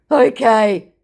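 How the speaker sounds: scammer voice. Retold or imitated. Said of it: "he said".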